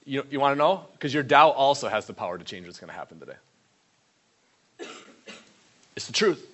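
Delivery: ordinary talk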